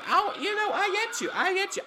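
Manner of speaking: high, laughing through the words; can't stop cackling. Real, not acted. Recalling the funny scene, laughing hard. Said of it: "I was cackling".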